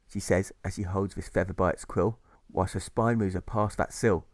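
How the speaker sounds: plain reading voice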